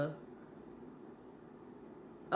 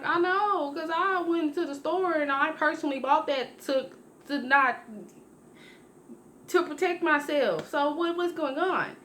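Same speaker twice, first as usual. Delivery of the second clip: much higher, frantic retelling of her own panic; panicked high pitch; 9 semitones higher than usual. Retold or imitated. To her guy friend, about her late cycle, panicked and high. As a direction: voice shoots up, alarmed and insistent.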